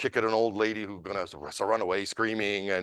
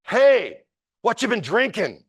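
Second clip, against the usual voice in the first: a much higher, louder call across the train car; a bright, sudden shout to interrupt the fight; cheerful high shout to a stranger; yelled out; 12 semitones higher, 6 dB louder, slower than usual. Retold or imitated. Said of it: "someone yells out".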